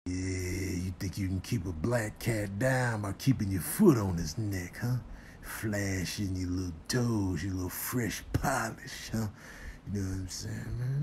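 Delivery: quiet, low sly sweet-talk; smooth-talking character. Pretend. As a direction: murmur it low and take your time. Voices a character in clip.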